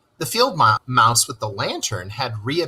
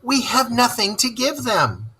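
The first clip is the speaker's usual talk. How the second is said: despair; 11 semitones higher than usual. A narrator's reading. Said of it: "he cried in despair".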